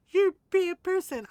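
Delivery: shrill up the villain, hammed up; mock outrage. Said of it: "He's like".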